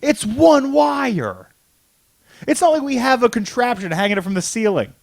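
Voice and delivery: exasperated protest. Real, not acted. Argues with co-host over unplugging gear, exasperated. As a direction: protest loudly that it's no big deal.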